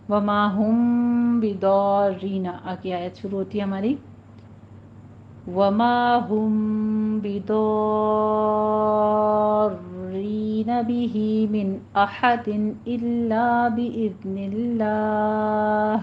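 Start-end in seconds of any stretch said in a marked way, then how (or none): 0.1-2.5 slow melodic tajweed chant; Quranic recitation. Acted. Teacher recites the verse for students, slow melodic chant. 5.5-15.9 slow melodic tajweed chant; Quranic recitation. Acted. Teacher recites the verse for students, slow melodic chant.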